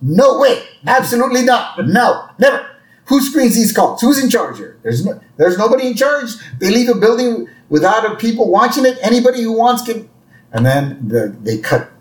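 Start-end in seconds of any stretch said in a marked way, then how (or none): 0.0-10.1 high, fast outraged tirade as a rabbi; livid rant. Retold or imitated. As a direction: act out losing it on air, rattling off objections.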